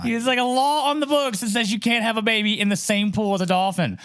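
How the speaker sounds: high, disbelieving run; incredulous. Real, not acted. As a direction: tell it like you can't believe it. Incredulous retelling of law.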